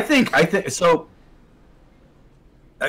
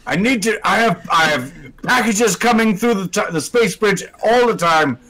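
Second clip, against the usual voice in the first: high, huffy robot rant; Shock Dave voice; 4 semitones higher than usual. Acted. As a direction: complain like an overworked alien. Shock Dave ranting at host.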